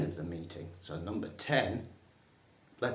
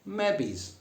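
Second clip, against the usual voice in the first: high, punchy Northern "Mebbies"; broad Northern "Mebbies" for maybe; 12 semitones higher than usual. Shown as a demonstration.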